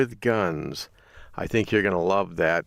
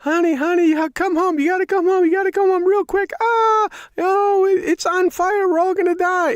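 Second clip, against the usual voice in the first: frantic, very high, loud screech of wife's panic; screaming panic; 8 dB louder than usual. Acted. Wife phoning husband about a fire at home, screaming. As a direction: imitate a wife shrieking in terror, hammed-up. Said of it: "she's screaming and, and yelling".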